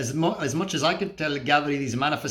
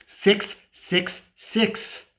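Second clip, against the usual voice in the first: very slow, each number separate; slow, portentous, spaced-out delivery; ominous count; 4 semitones higher, much slower than usual. Acted. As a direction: draw out each number like a grim revelation.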